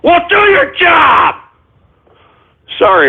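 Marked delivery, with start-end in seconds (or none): 0.0-1.4 host blowing up at his engineer; yelling at engineer. Acted. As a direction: bark it at the engineer who dropped the call.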